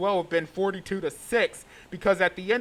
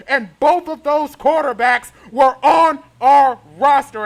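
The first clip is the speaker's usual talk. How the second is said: frustrated outburst; 6 semitones higher, 11 dB louder than usual. Real, not acted. Frustrated fan rant peaks.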